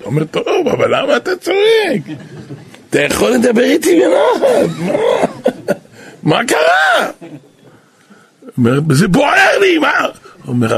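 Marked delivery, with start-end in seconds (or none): 0.2-7.1 high shouting, with laughter breaking through; hammed-up shout. Angry donor yelling at the rabbi, high and half laughing. 8.9-9.9 acted shout. The Saraf yelling that it burns in him, in a high shout. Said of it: "והתחיל לצעוק".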